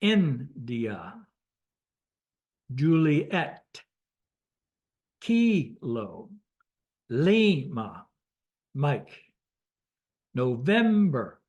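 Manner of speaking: each word alone, India to Papa, accented syllable punched; phonetic alphabet demo. Shown as a demonstration. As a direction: drill India, Kilo, November with drawn-out stressed syllables. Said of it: "shows you how you pronounce it".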